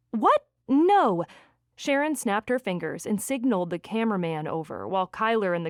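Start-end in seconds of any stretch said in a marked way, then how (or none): 0.1-1.2 high, loud shocked protest; startled denial. A narrator's reading. Ex accused of stalking by producer, startled denial. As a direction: blurt it out, taken aback.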